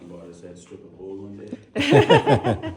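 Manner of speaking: loud, laughing through the line at his own joke. Real, not acted. Guide laughing at a visitor's cheeky answer, real laughter. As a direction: crack up while telling it. Guide jokes about cave formations.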